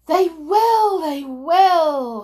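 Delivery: high, loud chant of agreement; excited crowd cry. Acted. Witches' audience agreeing with their leader, loud excited cry. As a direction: shout agreement like a thrilled crowd. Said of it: "cried the audience".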